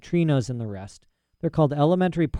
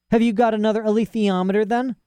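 put-on high, eager young girl; high-voiced girl talking to her father; girl's voice Lyra; 8 semitones higher than usual. A narrator's reading. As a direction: rattle it off like a bold child. Lyra challenging.

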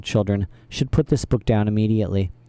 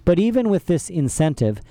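plain reading voice

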